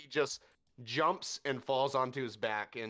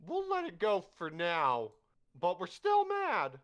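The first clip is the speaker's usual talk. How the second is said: high, loud, grudging voice; high squeaky mollified crowd; 11 semitones higher, 6 dB louder than usual. Acted. Mob placated by the drinks, high squeaky grudging voice.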